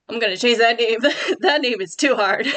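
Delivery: laughing at her own tongue-twister name; giggling at a silly name. Real, not acted.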